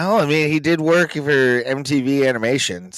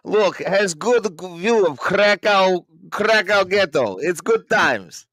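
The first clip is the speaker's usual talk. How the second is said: put-on Eastern European accent; 6 semitones higher, slower than usual. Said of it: "I'm terrible in Eastern European accents".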